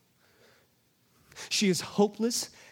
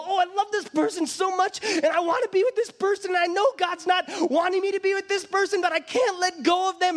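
higher-voiced lovesick believer, torn; 7 semitones higher than usual. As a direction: plead like someone who can't let go. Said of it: "you're in a relationship that you go".

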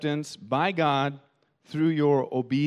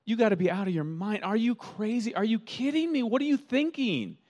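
incredulous; 8 semitones higher than usual. Retold or imitated. Pastor voicing Paul rebuking the Galatians, exasperated and high. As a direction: throw up your hands in disbelief. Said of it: "It's like Paul is saying".

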